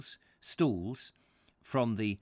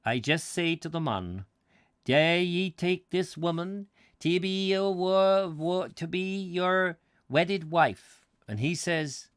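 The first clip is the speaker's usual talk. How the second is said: amateurish, higher-pitched Scots priest; hammed-up Scottish wedding officiant; attempted Scottish accent; 5 semitones higher, 4 dB louder than usual. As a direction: recite the vows in a put-on Scottish accent. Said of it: "I can't do the accent very well".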